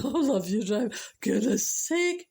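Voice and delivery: mock teasing. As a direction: mimic her playfully to tease. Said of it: "So I started doing the".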